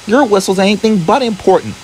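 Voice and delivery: high scoffing put-down; Sarah scoffing at Larry, high and scornful. A narrator's reading. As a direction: scoff at the boast. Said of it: "scoffed Sarah".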